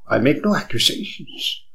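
hushed, breathy disclaimer; whisper. Acted. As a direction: whisper it as a careful aside. Cautious disclaimer in dialogue.

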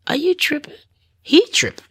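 high-pitched disbelieving retort; incredulous. Retold or imitated. Woman recalling her reaction to her dealers, incredulous.

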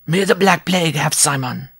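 high, fiery dwarf curse; angry cry. A narrator's reading. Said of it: "the dwarf cried, his eyes seeming to flash".

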